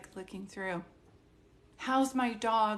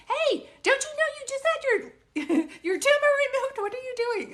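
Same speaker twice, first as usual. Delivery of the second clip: very high, louder play-scolding voice with a laugh; mock scolding the dog; 8 dB louder than usual. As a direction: tease the dog in a squeaky scold. Obviously joking around.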